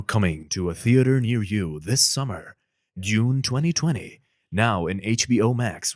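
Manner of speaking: loud, slow trailer announcer; movie trailer voice. Shown as a demonstration. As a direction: sell the summer blockbuster. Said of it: "the movie trailer voice".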